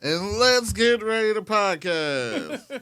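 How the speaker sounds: slow drawn-out announcer call; ring announcer. Acted. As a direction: announce it like a boxing match. Boxing announcer style opener.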